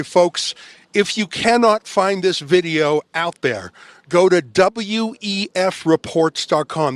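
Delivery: slow, deliberate address to viewers; direct to camera. Real, not acted. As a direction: spell out the website slowly. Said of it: "I wanna look straight at the camera and say".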